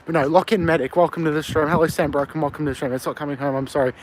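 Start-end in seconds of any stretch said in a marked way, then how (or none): none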